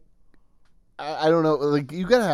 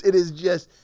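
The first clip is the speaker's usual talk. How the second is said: ordinary delivery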